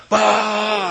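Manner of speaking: laughing imitated blast; heater blast. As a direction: bark it like the heater.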